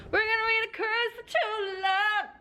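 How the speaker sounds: high thrilled squeal of the book title; squealing excitement. Real, not acted. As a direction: shriek the title with joy. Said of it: "So I'm so excited".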